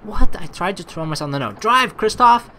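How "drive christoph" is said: higher, louder urgent command; urgent shout. Acted. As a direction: shout to the driver to speed up. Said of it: "I shouted urgently".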